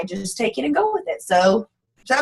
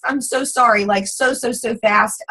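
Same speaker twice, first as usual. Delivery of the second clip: ordinary talk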